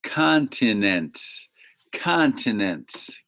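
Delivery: Continents stretched slowly, KON-ti-nents; teacher modelling slowly; slow model word. Shown as a demonstration. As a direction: drag out continents syllable by syllable. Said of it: "can you see it?".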